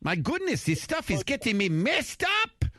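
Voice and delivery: very high mock German accent; comic German accent, slowing down. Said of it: "this is my best German accent".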